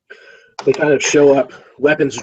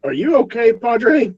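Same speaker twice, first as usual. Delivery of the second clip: much higher, concerned guard; worried guard; 10 semitones higher, slower than usual. Acted. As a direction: ask it with real worry. Guard worried about priest.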